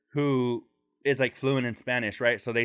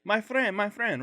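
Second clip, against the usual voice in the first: higher calling vendor; hat seller; 8 semitones higher than usual. Retold or imitated. Hustler calling the old man, high coaxing. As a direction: call it twice, coaxing. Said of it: "so he was like".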